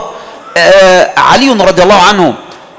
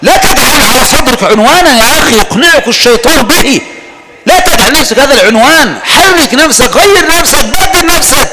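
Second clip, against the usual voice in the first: high, building sermon climax; fiery preaching; 6 semitones higher than usual. Acted. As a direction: rouse them, rising higher each line. (خطبة حماسية متصاعدة)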